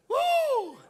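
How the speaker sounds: high, loud "uh!" whoop; excited whoop. Real, not acted. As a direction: let out a high whoop to fire up the crowd. Excited exclamation while preaching.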